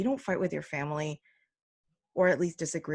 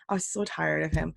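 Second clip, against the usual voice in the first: ordinary talk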